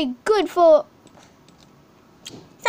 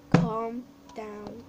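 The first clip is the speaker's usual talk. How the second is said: softer, lower self-soothing; calming down; 7 semitones lower, 7 dB quieter than usual. Real, not acted. The kid tells himself to calm down, softly. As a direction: talk yourself down quietly. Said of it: "I am now calm down".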